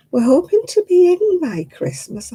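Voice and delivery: hushed, breathy imitation of the woman; lightly voiced estate woman, whispered; mock whisper. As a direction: mimic her in a sly whisper. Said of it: "the woman said to me".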